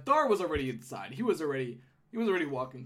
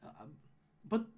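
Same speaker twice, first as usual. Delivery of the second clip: high-voiced gnome woman recalling a scare; Holly, concerned; 12 semitones higher than usual. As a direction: check on them anxiously in the gnome voice.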